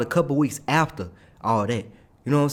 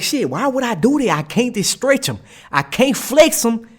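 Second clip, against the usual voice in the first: exasperated protest; 8 semitones higher than usual. Retold or imitated. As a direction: play up being stuck, pitched up. Acted protest over the job.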